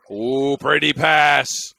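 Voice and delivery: drawn-out impressed call; admiring ooh. Real, not acted. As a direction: savour the pretty pass. Admiring a nice pass live.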